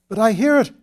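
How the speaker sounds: loud, high anxious protest. A narrator's reading. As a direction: insist in frightened protest. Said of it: "protested Peter".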